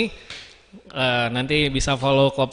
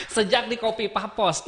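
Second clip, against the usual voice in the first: laughing; 8 semitones higher than usual. Real, not acted. Laughing after chemistry joke.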